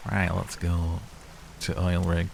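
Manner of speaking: put-on light northern English accent. Setting off for the oil rig in a light northern accent. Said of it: "so many northern accents".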